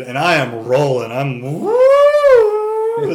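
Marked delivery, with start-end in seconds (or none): 1.4-3.1 a loud, very high woo of a rush; whooping. Retold or imitated. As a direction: let out a giddy whoop.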